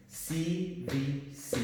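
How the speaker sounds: slow, deliberate C V C; letter by letter for the class; slow spelling. Shown as a demonstration. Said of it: "teacher spells letters slowly".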